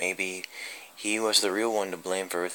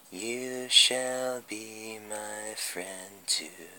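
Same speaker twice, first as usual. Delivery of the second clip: higher, slow, soft and eerie; eerie puppeteer; 6 semitones higher, 8 dB quieter, much slower than usual. Acted. The puppeteer lures a lonely victim, slow and softly eerie. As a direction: draw each word out like a creepy puppet master.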